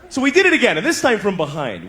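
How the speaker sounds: ordinary talk, nothing marked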